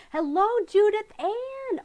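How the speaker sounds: delighted greeting. Real, not acted. Delighted greeting of an old viewer.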